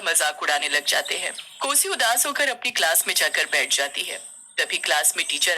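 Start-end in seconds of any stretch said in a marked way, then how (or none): none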